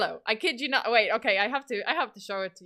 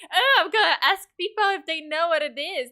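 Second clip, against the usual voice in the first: higher, louder, mock self-satisfied tone; mocking smug voice; 7 semitones higher, 7 dB louder than usual. Mimicking a smug Gen Zer holding a floppy disk, mock smug. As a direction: sneer like a know-it-all student. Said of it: "so smug".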